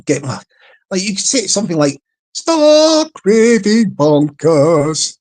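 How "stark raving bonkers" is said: loud, very slow, drawn-out silly voice; funny voice. Shown as a demonstration. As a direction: stretch each word out in a silly voice. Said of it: "say it in a funny voice".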